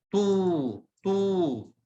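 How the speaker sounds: تو with a long, held "oo"; long vowel. Shown as a demonstration. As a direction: stretch the vowel in تو. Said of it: "مد بالواو".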